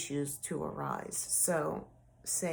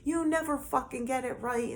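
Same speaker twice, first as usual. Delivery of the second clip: higher, mock furious accusation; a hint of his rant, retold; mimicking angry ex; 7 semitones higher than usual. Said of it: "And he was mad".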